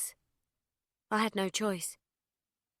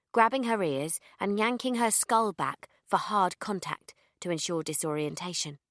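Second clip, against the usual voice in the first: plain reading voice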